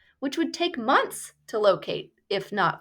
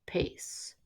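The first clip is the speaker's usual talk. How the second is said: whisper; 11 dB quieter than usual. A narrator's reading. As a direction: drop to a breathy whisper on the last word. Word painting a very slow pace.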